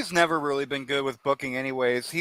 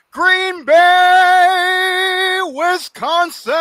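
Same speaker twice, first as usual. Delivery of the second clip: drawn-out hype announcer read; announcer intro; 13 semitones higher, 7 dB louder, much slower than usual. Acted. Host opening the stream with a hype call. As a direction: sell the show open like a ring announcer.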